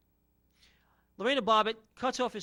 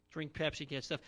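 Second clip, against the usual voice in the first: weary mutter; 5 semitones lower, 6 dB quieter than usual. Retold or imitated. Repeating slogan wearily.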